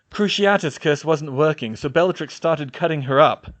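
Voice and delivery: angry snarl. A narrator's reading. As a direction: snarl it through anger. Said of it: "George snarled".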